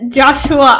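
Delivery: ordinary talk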